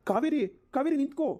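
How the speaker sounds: high-pitched, urgent call; pleading call after someone walking off; calling after her. Acted. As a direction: call out to stop her leaving. Husband calls after departing wife.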